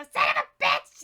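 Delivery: high, loud squawked curse; shrieking bird swearing from the rafters; crow voice. Acted. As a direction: screech it like an angry crow. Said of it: "like, this crow voice go".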